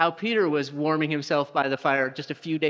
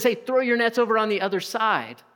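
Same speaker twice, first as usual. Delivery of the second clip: raised, high call from a distance; 6 semitones higher, 4 dB louder than usual. Retold or imitated. Jesus on the shore calls to the disciples, raised and high. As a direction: shout the advice across the water.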